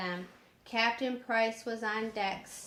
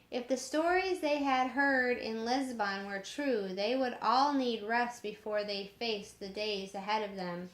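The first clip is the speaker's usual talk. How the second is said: usual speaking voice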